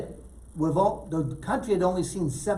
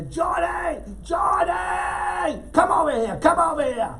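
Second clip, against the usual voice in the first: much higher, louder shouted call down a hallway; 13 semitones higher, 5 dB louder, slower than usual. Retold or imitated. Kinison calling Johnny over from down the corridor, loud shout. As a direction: yell his name from the far end of the corridor.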